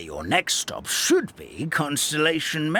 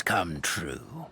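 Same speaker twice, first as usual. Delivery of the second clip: whispered; 10 semitones lower, 7 dB quieter than usual. Acted. Unvoiced whisper heard.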